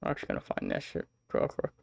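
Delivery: quick whispered aside to himself; rushed whisper to himself. Real, not acted. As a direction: mutter it fast under your breath. Muttered aside to self.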